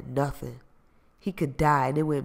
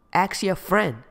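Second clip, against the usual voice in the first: louder, higher snappy comeback; spat retort; 5 semitones higher, 4 dB louder, slower than usual. Acted. Sahara snapping back at Qua, spiteful. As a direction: spit it back at him. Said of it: "she spat back at him".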